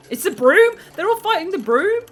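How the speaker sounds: loud, high cry of amazement; excited shout. Real, not acted. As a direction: exclaim loudly at a sudden commotion. Reacts to pigeons fighting a broom.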